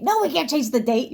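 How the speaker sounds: hammed-up snapping refusal; mock angry refusal. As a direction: bark the refusal back.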